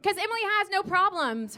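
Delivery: normal talking voice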